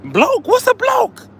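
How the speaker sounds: can't believe the word he heard; baffled. Retold or imitated. Vlogger reacts to being called a bloke, high and baffled. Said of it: "I'm like".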